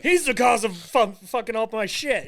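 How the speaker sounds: angry yell. Retold or imitated. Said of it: "he was just like".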